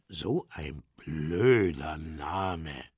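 low, slow bear voice; pooh-pooh it slowly and deeply; low, drawn-out bear grumble; dismissive bear. Acted. Bear dismisses the soap's name.

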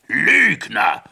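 loud, thundered accusation; thundering. A narrator's reading. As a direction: roar the word. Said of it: "donnerte der König".

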